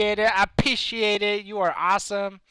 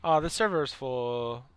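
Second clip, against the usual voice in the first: normal talking voice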